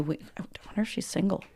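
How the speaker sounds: whispered teasing aside. Real, not acted. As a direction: lean in and whisper it.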